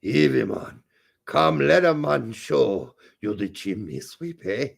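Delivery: slow, badly faked Jamaican with German tinge; German Jamaican accent. Dared by co-host to do it in the accent, German Jamaican. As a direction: do a botched German-sounding Jamaican. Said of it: "that was German Jamaican right there".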